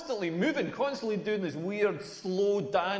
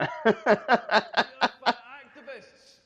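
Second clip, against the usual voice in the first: very slow; 4 semitones higher, 6 dB louder, much slower than usual. Acted. Slow mocking drag while laughing.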